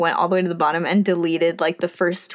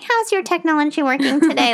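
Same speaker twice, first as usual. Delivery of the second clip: nasal Disney voice; 6 semitones higher than usual. Retold or imitated. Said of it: "nasal Disney voice".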